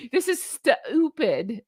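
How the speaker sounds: "stupid" split and stretched into "stu-upid"; mock mispronunciation. Shown as a demonstration. Host mocking the Spa Ace name, deliberately mispronouncing "stupid". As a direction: drag "stupid" out with a break in the vowel. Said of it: "only gonna pronounce things like that".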